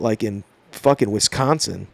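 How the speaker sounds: ordinary talk, nothing marked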